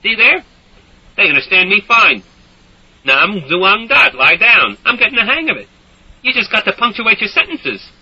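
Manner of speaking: high, loud, pleased with himself; smug bragging. A narrator's reading. Soldier bragging to Doc, loud and smug. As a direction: crow to Doc about it.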